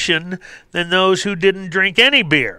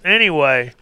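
ordinary talk

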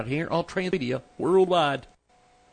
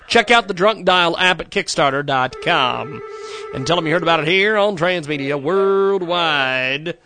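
loud, upbeat radio ad voice; ad read; 5 semitones higher, 10 dB louder than usual. Acted. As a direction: sell it like a pitchman. Host reads sponsor ads.

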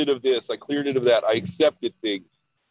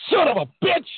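high squeaky exasperated curse; a comic burst of disbelief; exasperated outburst; 14 semitones higher than usual. Retold or imitated. Exasperated retold reaction, high.

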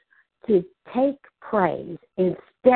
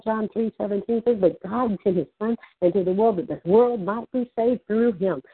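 normal talking voice

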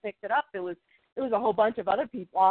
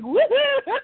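high, loud woohoo; gleeful whoop; 7 dB louder than usual. Retold or imitated. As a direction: whoop like doing a victory dance. Dark dances celebrating.

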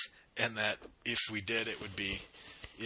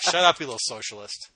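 louder, higher telling-off of a dog; mock scolding; 4 semitones higher, 11 dB louder than usual. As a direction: playfully tell the dog off.

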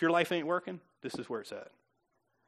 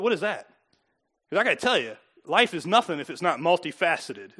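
ordinary talk

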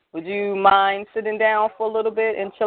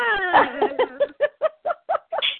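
high-pitched real laugh; high, drawn-out giggling laughter; 14 semitones higher than usual. Real, not acted. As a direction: burst into a long, high laugh. Shared laugh while inviting the poet.

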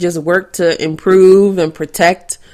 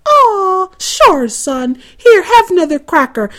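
imitating the mom; 8 semitones higher than usual. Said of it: "And they were saying".

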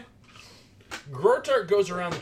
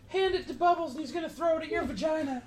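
much higher, laughing squeaky voice; 13 semitones higher than usual. Acted. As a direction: keep it shrill, barely holding laughter. High voice laughing bit.

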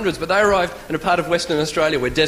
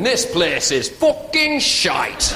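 put-on broad Yorkshire accent, slow and drawn-out; hammed-up Yorkshire verdict; Captain Cook; much slower than usual. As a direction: stretch it out in thick Yorkshire. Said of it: "Captain Cook actually came from Yorkshire".

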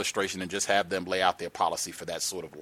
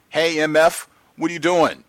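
loud, high mocking yell of a rude greeting; mock rude shout; 7 semitones higher, 10 dB louder than usual. Caller mocks a coworker's rude greeting, loud and high.

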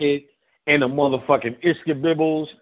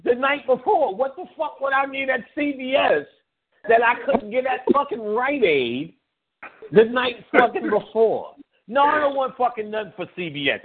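mock indignant; 8 semitones higher than usual. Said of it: "I said".

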